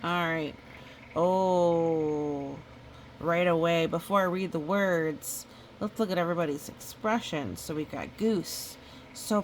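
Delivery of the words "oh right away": usual speaking voice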